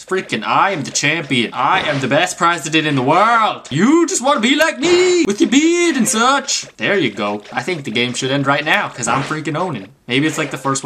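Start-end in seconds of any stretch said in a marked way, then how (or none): 3.7-6.7 very high, gloating jeer; mock taunt. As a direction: taunt the opponent in a high-pitched voice. Taunting the game opponent.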